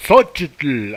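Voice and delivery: Xochitl with a loud clipped final tl; teacher's Xochitl. Shown as a demonstration. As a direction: stress the tl ending of Xochitl. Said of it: "así decía un maestro".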